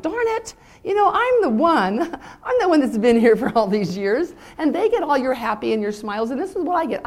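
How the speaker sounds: high, loud, half-laughing rant; exasperated outburst. Retold or imitated. Mother venting at her sullen daughter, loud and laughing. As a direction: blow up in laughing frustration. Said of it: "And I'm like".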